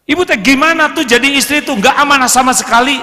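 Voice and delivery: fast, loud, high scolding husband; mock furious husband telling off his wife; angry husband. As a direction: berate her like an angry husband.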